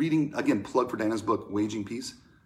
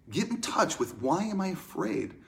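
usual speaking voice

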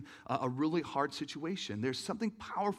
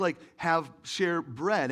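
ordinary delivery

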